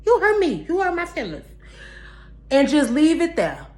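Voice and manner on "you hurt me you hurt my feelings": sassy mock voice. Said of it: "And I was able to just look like".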